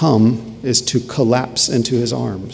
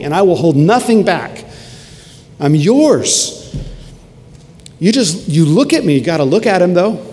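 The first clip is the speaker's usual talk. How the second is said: higher-pitched, surging sermon delivery; impassioned preaching; 4 semitones higher than usual. Acted. Preacher picturing the crucified Jesus for the congregation, impassioned. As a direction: preach it with mounting fervour.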